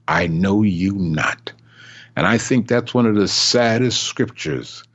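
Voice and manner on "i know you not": low, slow; God rejecting the false believer, low and slow; God's rejection. Acted.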